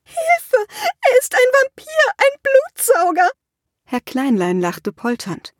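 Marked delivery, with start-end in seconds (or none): 0.0-3.3 much higher, terrified cry for help; panicked scream. A narrator's reading. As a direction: shriek as the vampire grabs her. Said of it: "taumelte sie zurück und schrie".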